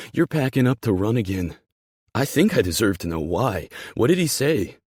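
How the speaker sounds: loud, heated confrontation; voice rising. Acted. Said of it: "my voice rising".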